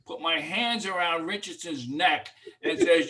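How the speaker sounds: normal talking voice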